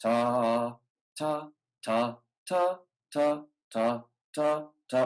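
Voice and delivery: ta, ta, ta with the tongue tip; tongue-tip ta for each note; single-tongued ta. Shown as a demonstration. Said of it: "practice that one single-tongued".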